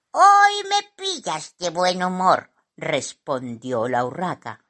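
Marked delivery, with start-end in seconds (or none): 0.1-2.5 lightly voiced bird, higher and louder; a hint of a cocky bird; magpie character. A narrator's reading. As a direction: answer breezily in a raised voice. Magpie answers Simón.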